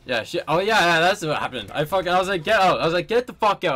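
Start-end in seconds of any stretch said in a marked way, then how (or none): 2.4-3.8 raised, louder and higher retelling of an order; retold yelling. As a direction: re-enact yelling, short of a full scream. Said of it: "I was fucking screaming".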